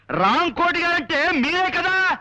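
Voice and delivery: slow, high-pitched shouted question; shouting. Acted. Visitor asks the old man if he is Ramkoti, shouting. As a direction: call out each word slowly. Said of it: "అంత గట్టిగా అరుస్తారు ఎందుకు".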